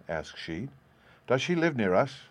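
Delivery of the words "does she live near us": plain reading voice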